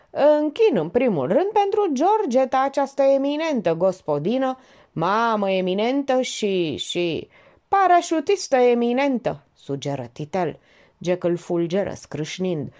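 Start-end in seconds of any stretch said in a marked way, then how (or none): 0.1-9.4 mock toast. A narrator's reading. Mocking toast for Georgeta.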